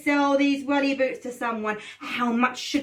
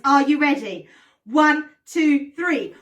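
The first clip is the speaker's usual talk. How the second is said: normal talking voice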